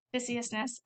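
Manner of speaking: ordinary delivery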